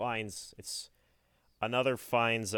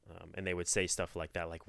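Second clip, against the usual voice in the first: ordinary talk, nothing marked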